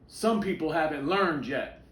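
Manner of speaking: a father's frustration breaking through; angry. A narrator's reading. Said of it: "said his father angrily".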